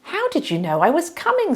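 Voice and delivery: high, bewildered asking; puzzled question. Acted. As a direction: sound truly baffled.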